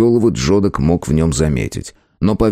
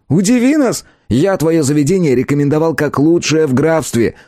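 lightly raised, cheeky elf voice; christmas elf; 9 semitones higher than usual. A narrator's reading. Elf character voice.

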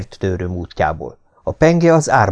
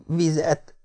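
faint, higher whimper; weak moan; 8 semitones higher, 7 dB quieter than usual. A narrator's reading. Injured man waking and begging for water, whimpering. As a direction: whimper it softly and weakly. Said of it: "nyöszörögtem".

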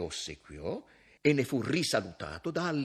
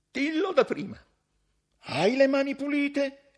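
high, loud shout across the room; shouted from afar; 9 dB louder than usual. Acted. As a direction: call out sharply to the barber. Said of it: "Gli gridò da lontano Cavaliov".

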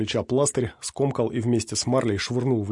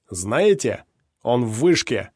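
hateful shout; 5 dB louder, much slower than usual. A narrator's reading. Raven full of hatred.

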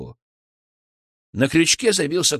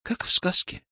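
high, breathy wonder; airy, awestruck murmur; sighing; 7 semitones higher than usual. Acted. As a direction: let it out on an enchanted sigh. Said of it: "вздохнула Вероника".

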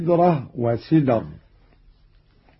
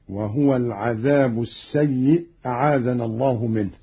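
ordinary talk

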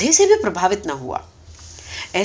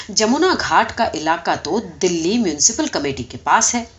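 ordinary delivery, nothing marked

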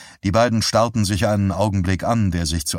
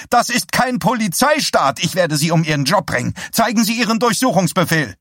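high, loud protesting cry; shrill cry; 11 semitones higher, 4 dB louder than usual. Acted. Woman blocking the sheriff from her house, shrill protesting cry. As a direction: cry out at him, pitched way up. Henrietta shrieking threats.

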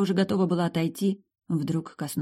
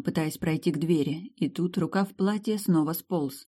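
plain reading voice

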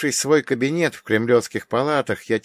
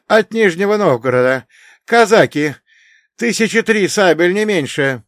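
wind knocked out; 9 semitones higher, 8 dB louder than usual. Acted. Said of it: "задыхаясь, прохрипел он".

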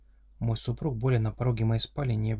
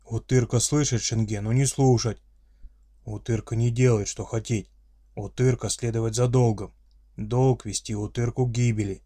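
friendly monster voice; 4 dB louder than usual. Acted. Orc father scolds daughter.

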